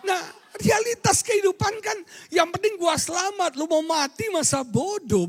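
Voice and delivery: high, loud preacher's cry; shouting preacher mocking selfish talk; fiery preaching. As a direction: rail against selfishness at full force. Preaching at full force.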